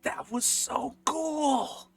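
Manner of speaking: put-on high, loud, breathless child gushing about the fight; excited kid. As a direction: gush like a wide-eyed kid who just saw a beheading. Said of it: "he goes".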